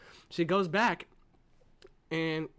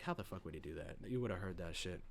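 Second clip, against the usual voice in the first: much lower, soft doubting grumble; low skeptical aside; 10 semitones lower, 11 dB quieter, faster than usual. Retold or imitated. Reviewer doubting the plot, low grumble. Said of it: "And I'm like".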